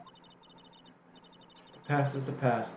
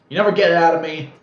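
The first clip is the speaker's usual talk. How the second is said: much louder, fast, higher yell; defiant shout; 6 semitones higher, 17 dB louder, faster than usual. Acted. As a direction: blurt it out defiantly. Defiant outburst.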